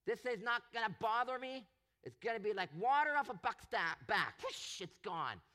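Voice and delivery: mock cheerful piety. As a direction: sing out a smug hallelujah as if nothing hurts. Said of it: "he didn't sit back and say".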